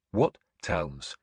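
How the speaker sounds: ordinary delivery, nothing marked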